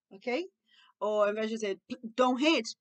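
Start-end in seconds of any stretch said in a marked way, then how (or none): 2.2-2.8 put-on brisk, firm order to a child; firm command. Shown as a demonstration. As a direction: tell a child firmly to stop. Contrasts scolding with gentle phrase. (ordem firme)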